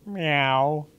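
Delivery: small guilty meow; sheepish kitten meow. A narrator's reading. The kitten admits to Emily that she played the tune, with a guilty meow. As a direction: confess like a caught kitten. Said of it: "admitted the kitten".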